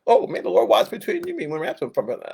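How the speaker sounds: mock sentimental. Mimicking churchgoers who love the verse, mock sweet. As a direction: coo it like a sweet blessing. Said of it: "they just found a really cool verse".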